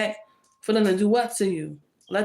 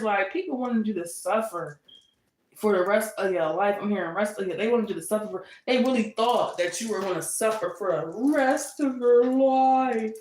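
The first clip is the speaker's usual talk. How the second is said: normal talking voice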